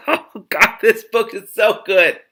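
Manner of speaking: laughing delight. Real, not acted. Reader praising the book aloud, laughing and thrilled. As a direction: savour it through laughter.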